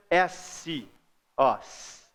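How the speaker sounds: teeth closed, long S hiss; sustained S. Shown as a demonstration. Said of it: "você fecha". (S sibilado, dentes fechados)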